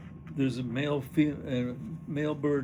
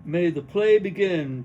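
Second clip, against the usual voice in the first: loud, higher stage announcement; grand announcement; 4 semitones higher, 9 dB louder than usual. Acted. Kitty opening the show for the audience, loud and proud. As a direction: proclaim it to the whole hall.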